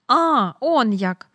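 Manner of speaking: повільно й гучно, ніби осяяло; розтягни подив на весь вигук; протяжне здивування. A narrator's reading. Адмірал раптом розуміє. (адмірал, зрозумівши, повільно й гучно дивується)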